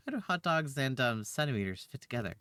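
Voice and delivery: normal talking voice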